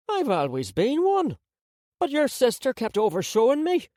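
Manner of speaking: high, quick grandmother retort; shot back. A narrator's reading. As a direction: fire it back at him. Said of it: "she shot back".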